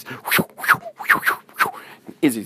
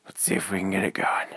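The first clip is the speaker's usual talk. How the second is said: whisper; faster than usual. A narrator's reading.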